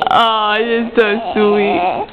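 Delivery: low, drawn-out motherly coo; tender cooing. Real, not acted. Mother getting a hug from her baby, low tender cooing.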